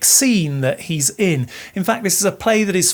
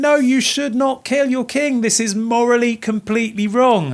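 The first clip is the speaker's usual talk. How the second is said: higher-pitched mock outraged protest; mock scream; 5 semitones higher than usual. Teacher voicing a shocked Jacobean audience, mock screaming. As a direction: imitate an appalled crowd, pitched up, not really screaming. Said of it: "they would be there screaming".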